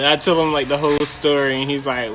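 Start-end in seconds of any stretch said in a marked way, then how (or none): none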